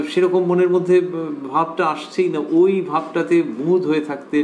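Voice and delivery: normal talking voice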